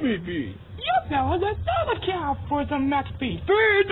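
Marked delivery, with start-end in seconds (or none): 3.5-3.9 loud excited yell; shouting. Acted. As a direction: shout the word out. Repeated loud cry.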